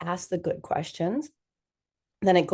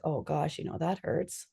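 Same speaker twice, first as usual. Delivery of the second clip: much softer, a touch of hurt; 3 semitones lower, 8 dB quieter than usual. Shown as a demonstration. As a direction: say it quietly to yourself. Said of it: "little eye tear".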